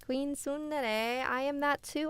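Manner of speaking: high, drawn-out delight at a match; excited discovery. Real, not acted. Streamer telling a viewer they share a background, excited. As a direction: call out in high-pitched recognition.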